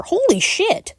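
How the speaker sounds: high-pitched shocked outburst; shocked exclamation. Real, not acted. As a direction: blurt it out in real shock. Reacting to body found.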